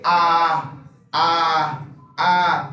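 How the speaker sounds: A sounded fully open. Shown as a demonstration. Teacher modelling the open A for students. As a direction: open A held wide and clear. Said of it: "el sonido abierto".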